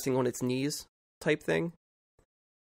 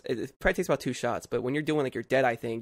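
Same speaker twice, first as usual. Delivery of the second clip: ordinary delivery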